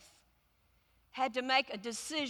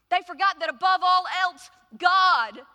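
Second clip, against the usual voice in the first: preaching climax; 5 semitones higher, 9 dB louder than usual. Real, not acted.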